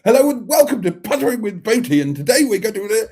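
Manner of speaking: high, fast, loud hyper TV host voice; fast presenter impression. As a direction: rattle it off like a breathless show host. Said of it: "he talks too quickly".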